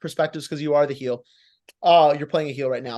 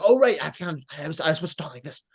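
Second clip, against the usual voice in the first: forgetful performer; faster than usual. Retold or imitated. Coach imitating a wrestler losing the voice, mock scrambling. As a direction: imitate someone scrambling back into character. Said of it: "then it kind of fades away".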